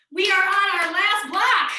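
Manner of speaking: playfully exaggerated Midwestern twang, belted out; Midwestern accent. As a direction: call it out in a big Midwestern accent. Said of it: "that was my Midwestern accent".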